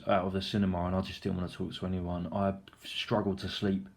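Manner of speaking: ordinary delivery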